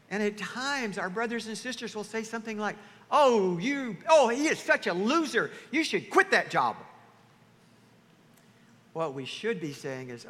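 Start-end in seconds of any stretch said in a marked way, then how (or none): 3.1-6.7 loud, high mock outrage on a friend's behalf; hammed-up indignation; indignant siding. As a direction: play the outraged friend egging someone on. Mocks friends' indignant reaction.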